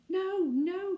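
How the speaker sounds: high, loud cry of protest; cried out. A narrator's reading. Anthea crying out as Cyril offers the baby away. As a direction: burst out in alarm. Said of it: "cried Anthea".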